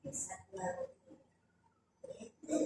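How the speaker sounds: lento, agudo y suave, en tono de broma; respuesta burlona. Retold or imitated. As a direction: alarga cada palabra como quien se burla. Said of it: "Yo digo". (broma lenta y suave)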